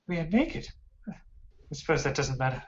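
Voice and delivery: halting, airy objection, then giving in; hesitant captive elf. Acted.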